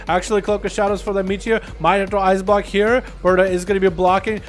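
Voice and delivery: fast-running esports commentary building to a big cry; live play-by-play. Real, not acted. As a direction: call the match live, rising to the climax.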